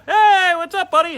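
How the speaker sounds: loud, high, hearty fake cheer; booming fake-friendly hello to a regular; fake buddy greeting. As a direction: overdo the friendly bartender hello.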